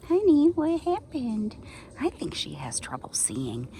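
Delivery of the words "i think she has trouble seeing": worried whisper aside. Real, not acted. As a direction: whisper your concern. Aside whispered near the pet.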